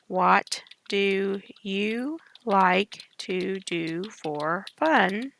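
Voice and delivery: drawn-out dictation; dictating slowly. Shown as a demonstration. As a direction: read it out slowly while typing. Teacher dictating slowly while typing.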